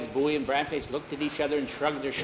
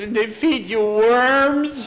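high, loud, slow disgusted question; horrified; 8 semitones higher, 8 dB louder, much slower than usual. Acted. Skeeter asks in horror about eating worms, high and slow. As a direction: recoil at the thought.

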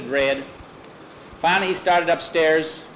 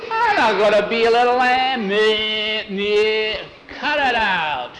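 higher and louder, bleating meh meh; mock lamb bleat; 7 semitones higher, 3 dB louder, slower than usual. Mike protesting the lamb role with bleats.

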